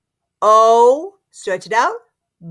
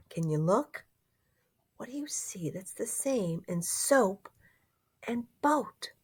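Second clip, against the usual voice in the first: whisper; 13 dB quieter than usual. Acted. Teacher whispers to children.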